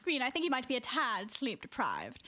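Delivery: understated posh British; posh British accent. Pretend. Streamer gently teasing giggling friend, posh British accent. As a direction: diagnose it in proper British English. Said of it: "posh British accent".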